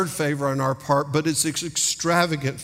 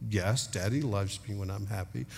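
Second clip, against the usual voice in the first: put-on small, soft, lower-pitched child's reply; imitated child's answer; 5 semitones lower, 8 dB quieter than usual. Child answering Daddy in bed at night, quietly dutiful. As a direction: imitate a little one answering quietly by rote.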